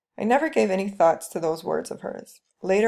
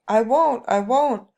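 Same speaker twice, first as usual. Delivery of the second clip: mild mock scream of a girl, louder; screaming refusal; 4 semitones higher, 6 dB louder, slower than usual. Young bride screaming at her husband at night, loud retold scream. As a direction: cry out I won't in protest. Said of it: "a loud scream woke me up".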